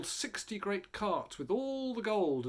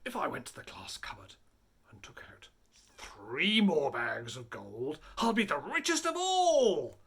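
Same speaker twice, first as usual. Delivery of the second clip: scheming robber chief; 5 dB louder than usual. A narrator's reading. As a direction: plot it, then gloat. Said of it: "He kept thinking".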